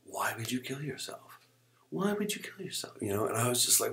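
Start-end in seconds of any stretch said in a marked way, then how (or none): 0.0-1.4 imitating a disgusted doctor, slightly raised; disgusted question. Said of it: "just disgusted, man". 1.9-3.0 much higher, incredulous repeat; disgusted disbelief. Retold or imitated. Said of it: "just disgusted, man".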